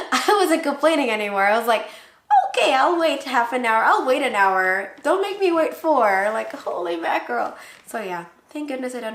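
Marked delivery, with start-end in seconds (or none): 2.5-6.3 loud, higher-pitched mock protest; exasperated complaint. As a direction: plead loudly, fed up with waiting. Acted complaint about the long wait.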